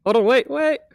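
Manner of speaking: high, loud call to wait; urgent call. Real, not acted. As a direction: stop them before they step. Stopping player from stepping forward.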